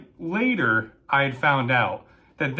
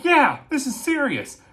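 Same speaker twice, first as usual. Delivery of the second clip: high-pitched, emphatic retort; urgent insistence; 10 semitones higher than usual. Retold or imitated. Dispatcher answering the doubting fire dispatcher, urgently insistent.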